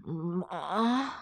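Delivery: drawn-out wordless grunt of waking; groggy guard's mumbled moan; sleepy moo. A narrator's reading. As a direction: moan it as if just woken. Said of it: "промычал толстый охранник".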